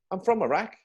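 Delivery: high-pitched, small reply; imitated sheepish reveal. Colleague answering the awkward question, mock innocent. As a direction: play the colleague owning up innocently.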